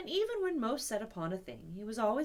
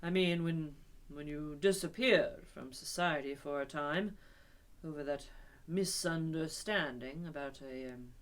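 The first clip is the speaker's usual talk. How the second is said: tactful hesitation; 6 semitones lower than usual. Acted. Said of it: "slowly and impressively".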